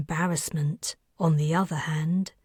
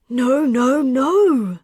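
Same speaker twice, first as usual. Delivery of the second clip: loud, high panicked no; panicked crying out; 5 semitones higher, 5 dB louder, slower than usual. Acted. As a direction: cry out in terror, louder each time. Buried alive, panicking.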